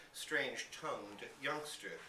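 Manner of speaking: ordinary delivery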